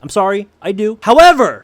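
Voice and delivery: loud. Real, not acted. Apologetic, then bursts out.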